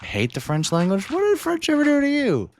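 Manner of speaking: squeaky high mock outrage; mock indignant. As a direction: pretend to be deeply offended. Mock defending French to chat.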